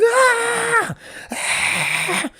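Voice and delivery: shrill high imitated shriek; mock demon scream. Host imitating the screaming demon, very high. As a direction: shriek like the possessed thing. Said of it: "it was screaming".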